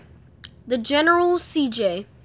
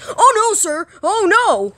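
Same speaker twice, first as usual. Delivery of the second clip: high, panicky soldier; raised, mock-alarmed trooper voice; 6 semitones higher, 4 dB louder than usual. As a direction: cry out like a toy soldier in trouble. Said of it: "He goes like this".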